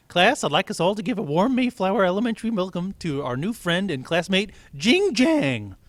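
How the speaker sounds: high, loud, bright teacher announcement; teacher introducing the new kid; cheery teacher. Acted. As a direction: welcome the class like a chipper teacher.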